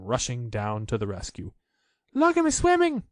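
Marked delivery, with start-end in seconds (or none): 2.1-3.0 very high, loud squeaky little voice; Roo squeaking. A narrator's reading. Roo showing off his swimming, high squeak. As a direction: squeak it like a tiny proud joey. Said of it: "squeaked Roo".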